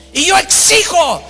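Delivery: high, emphatic mock demand; acted demand. Preacher making demands of the airline clerk, acted imperious anger. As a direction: imitate an imperious passenger putting his foot down. Irate passenger demands at desk.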